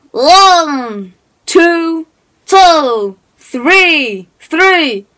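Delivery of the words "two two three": ordinary delivery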